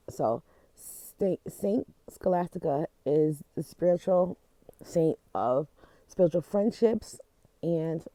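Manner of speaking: normal talking voice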